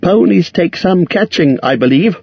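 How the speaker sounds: high, courteous hobbit voice; polite hobbit. A narrator's reading. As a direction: edge away politely. Bilbo excuses himself.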